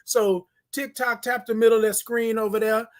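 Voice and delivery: ordinary talk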